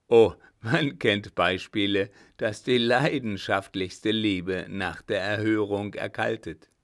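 loud, pointed warning; insistent warning. Acted. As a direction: press the point hard. Brother warns the baron.

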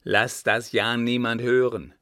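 put-on loud, emphatic warning from an old servant; urgent exclamation. A narrator's reading. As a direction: call it out as an urgent caution to a child. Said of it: "rief Florian".

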